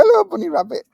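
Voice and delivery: very high, sing-song hello; cooing to a bunny. Acted. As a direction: coo at a cute pet. Greeting the rabbit.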